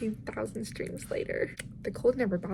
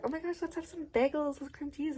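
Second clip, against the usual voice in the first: excited suggestion; 7 semitones higher, 6 dB quieter than usual. Retold or imitated. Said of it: "And I was like".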